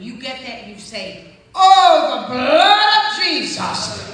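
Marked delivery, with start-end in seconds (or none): 1.5-4.0 loud, high, slow proclamation; ringing preacher cry; triumphant shout. Real, not acted. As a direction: proclaim the blood over the demons.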